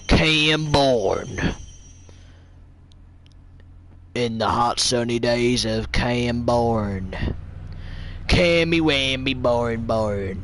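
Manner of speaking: slow American Western narrator; American accent. Pretend. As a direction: drawl it like a cowboy film voice-over. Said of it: "You sound American".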